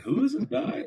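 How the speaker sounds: very high-pitched puzzled question; baffled reaction. Retold or imitated. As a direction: ask it like you've never heard of him. Reacting to unknown coaching hire.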